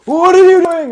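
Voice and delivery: high, loud mock outburst; indignant outcry. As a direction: cry out at the pesky ghost. Acted Darwin startled by ghost.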